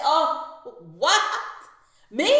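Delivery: high, loud shocked gasp of a reply; sharp incredulous oh, what, me; stunned disbelief. Retold or imitated. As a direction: react like you've just been wrongly accused. Stunned reaction to false accusation.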